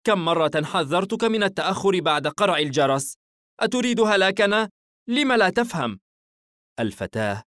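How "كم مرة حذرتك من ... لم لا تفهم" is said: raised, higher mother's telling-off; exasperated reproach, high and loud; scolding. A narrator's reading. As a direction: scold a child sharply, voice pitched up. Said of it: "بنبرة توبيخ".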